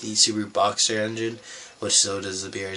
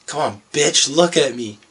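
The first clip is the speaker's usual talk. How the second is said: mock frustrated call, a bit louder; 5 semitones higher, 7 dB louder than usual. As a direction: imitate a buddy losing patience with a model. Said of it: "he's getting frustrated".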